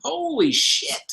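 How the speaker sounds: high-pitched retold astonishment; amazed realization. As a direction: relive the moment it clicked, thrilled. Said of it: "I'm like".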